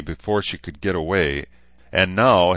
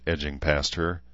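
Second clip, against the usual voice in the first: plain reading voice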